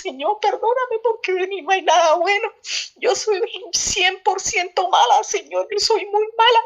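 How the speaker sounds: high, tearful plea to God; tearful confession. Retold or imitated. Tearful retelling of her repentance prayer.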